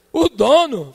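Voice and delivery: high, loud incredulous question; mock disbelief. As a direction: scoff at the claim. Doorman's mocking question.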